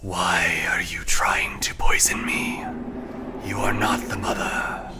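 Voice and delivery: slow eerie whisper; a starving tree hissing through leaves; talking tree. Acted. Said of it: "the tree speaks back to you".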